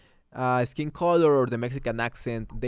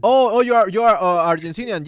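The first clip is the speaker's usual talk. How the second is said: surprised; 7 semitones higher, 8 dB louder than usual. Real, not acted. Surprised reaction to a chat comment.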